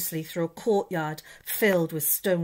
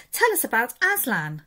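put-on children's eager plea, higher; 6 semitones higher, 4 dB louder than usual. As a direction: beg to hear the story.

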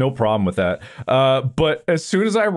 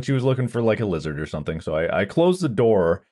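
ordinary delivery

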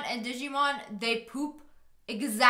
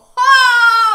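high loud squealing laugh; cracking up hard; shrieking laugh; 12 semitones higher, 17 dB louder than usual. Real, not acted.

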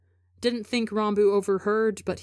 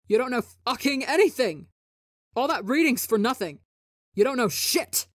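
higher, louder furious yelling; sharp angry outburst; 6 semitones higher, 4 dB louder than usual. Acted. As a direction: lash out in fury. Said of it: "Voice pitching up and bleeding sharp with anger".

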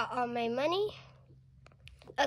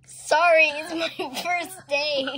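flustered apology; 4 semitones higher, 9 dB louder than usual. Pretend. Flustered pretend dentist apologizes.

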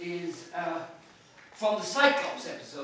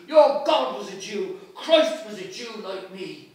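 defiant shout; 10 dB louder than usual. Acted. Bloom retorting to the citizen, loud defiant. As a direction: fling it back at him. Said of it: "says he".

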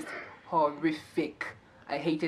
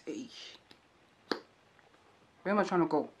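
ordinary talk, nothing marked

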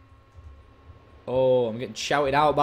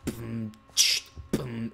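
low beatbox boom and hiss; beatbox a bass beat by mouth; vocal bass drops; 5 semitones lower, 4 dB quieter, slower than usual. Pretend. Player fakes his sound system, low beatbox. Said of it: "the sound of me dropping the bass".

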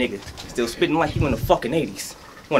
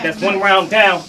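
ordinary talk, nothing marked

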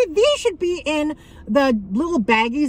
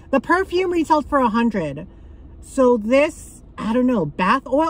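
ordinary delivery

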